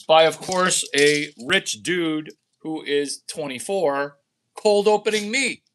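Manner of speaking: normal talking voice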